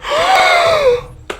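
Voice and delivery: high, loud astonished gasp; imitated gasp. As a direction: gasp in wide-eyed wonder like a kid. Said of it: "he went like".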